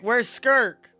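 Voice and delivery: ordinary talk, nothing marked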